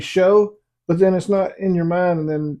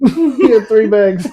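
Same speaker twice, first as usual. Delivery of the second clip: laughing; 6 semitones higher, 7 dB louder, much slower than usual. Real, not acted. Laughing at the gory twist.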